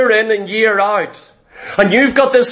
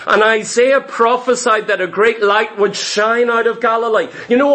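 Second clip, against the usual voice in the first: usual speaking voice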